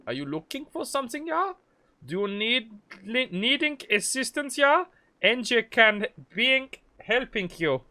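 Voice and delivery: hammed-up German accent. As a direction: lay on the German shopkeeper thick. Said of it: "this is the good accent".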